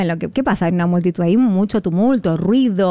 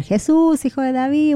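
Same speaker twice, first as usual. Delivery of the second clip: retold cry for help; 6 semitones higher than usual. Preacher voicing blind Bartimeo calling to Jesus, mild mock shout. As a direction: imitate calling out, pitch lifted. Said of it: "empezó a gritar".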